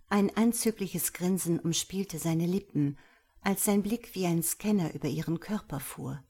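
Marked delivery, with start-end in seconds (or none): none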